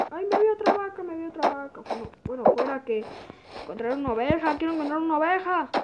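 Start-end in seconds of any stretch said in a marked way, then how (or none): 0.1-1.8 excited. Real, not acted. Excited at spotting a cow.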